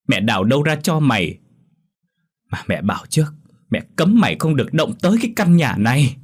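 furious mother hissing high and loud; angry hiss. A narrator's reading. Mother scolding her son for money, furious hiss. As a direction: spit it out in a rage. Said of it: "Bà Ngạ điên tiết rít lên".

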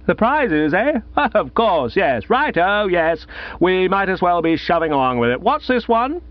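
high, merry tipsy chatter with a laugh; tipsy jovial man. Acted. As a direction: bluff and jolly, a little drunk. Gussie resumes, merry and tipsy.

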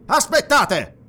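high, loud call to stop; commanding cry, pitched high; urgent halt. A narrator's reading. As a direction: call out sharply to stop them. Halts men about to attack.

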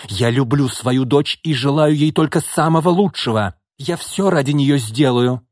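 acted vehement retort; angry cry. A narrator's reading. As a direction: insist heatedly as a wronged mother. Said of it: "гневно крикнула она".

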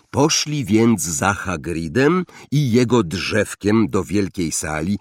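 usual speaking voice

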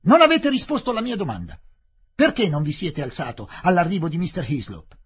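very loud, high insistent questioning; angry demand. Acted. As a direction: hammer the question home. Collector presses the question angrily.

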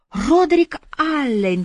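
high, drawn-out lilting echo; teasingly sung-out name, slow and high; singsong. A narrator's reading. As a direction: croon the name slowly, up high. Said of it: "пропела Бобби".

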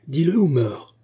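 wounded man crying out a threat; threatening cry. A narrator's reading. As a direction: demand it on pain of death. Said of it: "s'écria le blessé".

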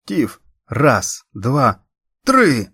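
loud urgent count, building to three; counting shout. A narrator's reading. Erin counts Tiffany through the push, building shout. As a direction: count it out, last number shouted high.

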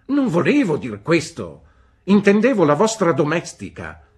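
high, loud, brusque "Bene?"; stern old aunt. A narrator's reading. Aunt Betsy demanding news from the mother, brusque. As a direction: demand an answer like a sharp old lady.

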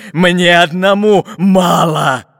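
loud, drawn-out greedy grumble; grumbling pirate. A narrator's reading. Captain refusing to share the gold, grumbling. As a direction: grumble it with stretched, heavy words. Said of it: "пробурчал капитан Буль-Буль".

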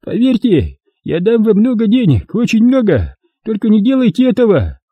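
high, desperate pleading; desperate bribe. A narrator's reading. Merchant pleading with his torturers, desperate. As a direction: offer anything to live.

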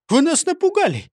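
high, aggrieved protest; startled complaint. Acted. Startled fireman protests to the police chief, high complaint.

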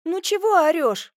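high-pitched, annoyed retort; irritated scolding. A narrator's reading. Semyonovna answers the shouting major, high irritated scolding. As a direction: tell off a noisy visitor.

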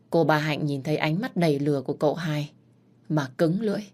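plain reading voice

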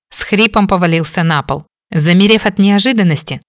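plain reading voice

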